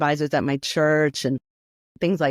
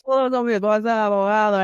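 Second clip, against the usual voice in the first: put-on commanding Dominican accent; 5 semitones higher, faster than usual. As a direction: lay down the law in a Dominican accent. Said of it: "this deep Dominican accent that, like, demanded it".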